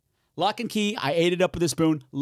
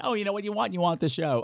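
normal talking voice

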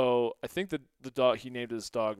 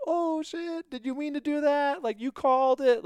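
amazed teammates; 13 semitones higher, 5 dB louder than usual. Retold or imitated. Teammates react in disbelief.

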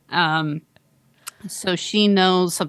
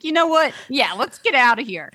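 eager mock quote; 7 semitones higher than usual. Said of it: "She's like".

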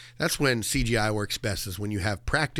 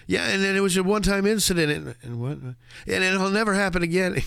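high comic impression; 8 semitones higher than usual. Imitating the hearing.

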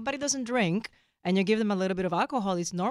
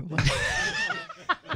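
high laugh. Real, not acted. Laughing at the story.

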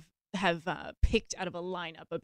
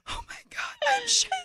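very high-pitched excited squeal; mock freak-out, shot way up high; excited sister. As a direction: squeal it, imitating an overwhelmed young attorney. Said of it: "I just imagine her, like, first year attorney".

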